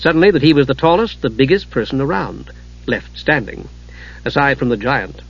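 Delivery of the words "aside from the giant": usual speaking voice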